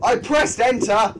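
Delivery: loud, slow exasperated cry; frustrated shout. Real, not acted. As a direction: protest loudly, exasperated. Frustrated at game mistake.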